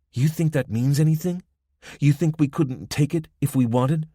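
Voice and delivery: a cool threat, no smile now; hardened young gang woman. Acted. Young woman at the roadblock threatening the travelers, cool Spanish-accented menace.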